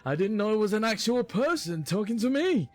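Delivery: high-pitched, defensive excuse; flustered protest. Acted. Dragonborn excusing himself to the small lady, flustered.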